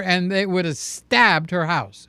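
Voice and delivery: ordinary talk, nothing marked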